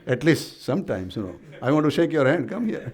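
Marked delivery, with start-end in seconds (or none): 1.6-2.9 raised voice breaking into laughter; amused, laughing. Real, not acted. Preacher inviting an honest child up for a handshake, amused and laughing. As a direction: laugh as you invite them over.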